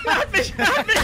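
shrieking high panic with a laugh; panicking gamer. Real, not acted. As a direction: scream it, half laughing in terror. Caught by Bigfoot, screaming.

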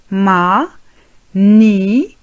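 "Magnifique" in three separated, drawn-out syllables; very slow split "Magnifique", pause after each syllable. Shown as a demonstration. Teacher modelling French for Arabic learners, syllable by syllable "Magnifique".

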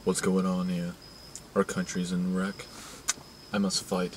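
low, very soft action-hero impression; underplay it as a hushed, low movie tough guy; Steven Seagal. Mocking the movie star J T loves, low quiet impression. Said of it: "You know, the guy that's like".

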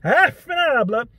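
loud, high mock exclamation; imitating dad's fake Italian curse; dad's reaction. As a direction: blurt it out at a disaster. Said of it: "some kind of horrible thing happened".

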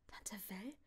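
soft, low disbelieving whisper; stunned whisper. A narrator's reading. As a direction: breathe it in disbelief. Narrator stunned.

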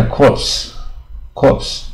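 CORPSE with p and s both sounded; slow, high "corpse" with every final consonant heard; spelling pronunciation. Shown as a demonstration. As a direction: read "corpse" as spelt, ps hissed at the end. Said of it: "你看这应该corpse".

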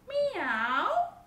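loud, high storybook meow; cat's meow. A narrator's reading. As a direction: meow out loudly like a cat. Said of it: "said the cat".

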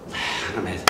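whispered, under the breath; fed-up mother whispering a curse at her son. Acted. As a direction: hiss it to yourself in disgust.

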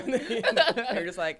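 slow words through loud laughter; laughing. Real, not acted. Actor laughing through her story, loud. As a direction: talk while cracking up.

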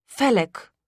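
plain reading voice

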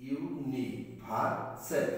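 ইউনিভার্সেল very slow, each syllable apart. Shown as a demonstration. Teacher marking syllables for students, syllable by syllable. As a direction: break ইউনিভার্সেল into spaced syllables. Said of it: "এরকম syllabus mark করে".

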